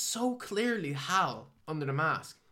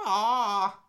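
higher, louder beastly roar; werewolf howl; 7 semitones higher, 8 dB louder than usual. Retold or imitated. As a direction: let out a mock monster howl. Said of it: "he becomes, like, a werewolf".

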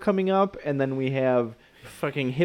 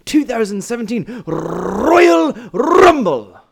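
strained held-breath announcer voice; holding breath; 3 semitones higher, 3 dB louder than usual. Pretend. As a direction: talk while holding your breath. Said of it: "I thought that was your new voice".

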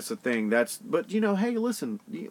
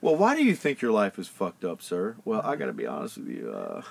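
lower, quicker, mock-presidential confiding; Bill Clinton voice; 6 semitones lower than usual. Friend asks why life went wrong, answered as Bill Clinton. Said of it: "in a Bill Clinton voice".

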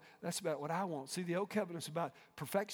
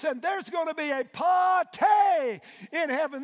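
much higher, louder shouted sermon; preaching at full force; 8 semitones higher, 9 dB louder than usual. Acted. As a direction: ring it out to the rafters.

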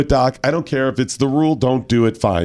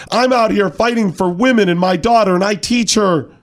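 high mock-righteous protest; indignant player; 7 semitones higher than usual. Host mocking a player's appeal to the umpire, mock indignant. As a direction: imitate an aggrieved player pleading her case. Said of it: "got indignant over and over".

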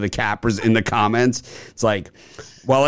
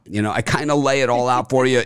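ordinary delivery

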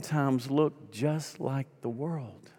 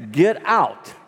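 raised, louder acted command; forceful order; 5 semitones higher, 5 dB louder than usual. Pastor ordering a deacon out of bed, forceful. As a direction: throw him out with your voice.

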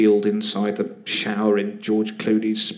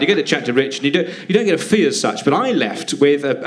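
usual speaking voice

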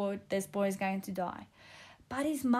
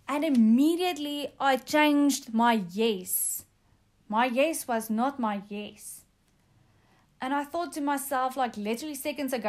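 usual speaking voice

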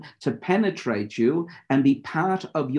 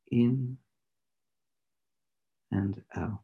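guided meditation; 2 semitones lower than usual. Acted. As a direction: lead them into stillness, unhurried.

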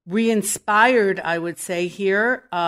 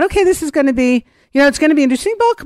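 quick, higher, louder aside of a sceptical thought; mock inner thought; 6 semitones higher, 4 dB louder, much faster than usual. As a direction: rattle it off like a shrugging first impression. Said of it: "I thought".

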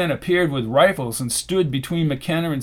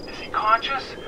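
high, soft, thin phone voice; voice over a device; 7 semitones higher, 5 dB quieter than usual. A narrator's reading. Annie asks after the wounded man, thin phone voice. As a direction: ask through a filtered line.

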